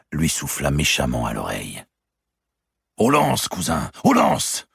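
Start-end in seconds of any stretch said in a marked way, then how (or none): 3.0-4.7 higher and louder, slow war call; rally the knights to arms; Clermont's battle cry. A narrator's reading. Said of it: "cria Clermont".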